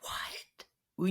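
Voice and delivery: breathy whispered what; stunned whisper. Retold or imitated. To the boy, a shocked whisper. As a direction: barely voice it. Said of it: "I was just like".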